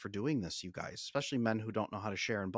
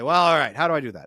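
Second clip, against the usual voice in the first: quoting clients; 3 semitones higher, 12 dB louder than usual. Retold or imitated. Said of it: "Then they say".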